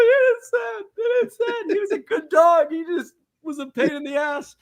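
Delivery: hammed-up tearful wail; mock sobbing. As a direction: wail it out like a kid who's been crying. Acting out Brian crying over film.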